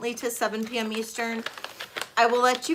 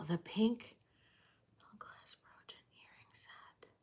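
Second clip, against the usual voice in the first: whispered to herself, barely audible; whispering; 16 dB quieter than usual. Real, not acted. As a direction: breathe the words under your breath. Rereading item name to herself.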